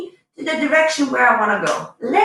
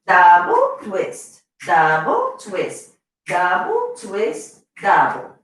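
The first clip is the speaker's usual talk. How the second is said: slow called cue; 5 semitones lower, slower than usual. Shown as a demonstration. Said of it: "calling dance counts".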